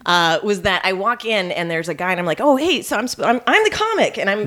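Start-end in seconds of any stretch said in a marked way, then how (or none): 2.4-4.2 chirpy, much higher and eager; all rainbows and twinkles; bubbly eager. Retold or imitated. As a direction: sparkle like a fresh new comic. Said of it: "full of twinkles and sparkles and rainbows".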